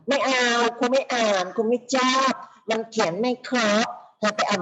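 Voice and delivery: by-the-book teacher. Acted. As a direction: play a rigid teacher rejecting homework. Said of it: "เขาเรียกเป็นครูแบบฉบับอะ".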